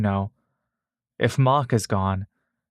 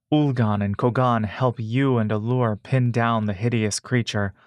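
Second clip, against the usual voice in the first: ordinary delivery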